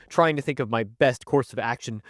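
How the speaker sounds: ordinary talk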